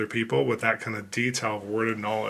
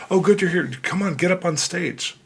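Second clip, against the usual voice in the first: higher, louder eager welcome; higher upbeat urging; eager greeting; 8 semitones higher, 4 dB louder than usual. Retold or imitated. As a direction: hurry him up on stage. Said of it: "they're like".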